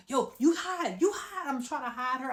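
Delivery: high, loud, urgent order; acted panic. Girl telling her friend to hide from dad, panicking. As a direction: push the voice up in mock panic. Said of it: "So I'm panicking".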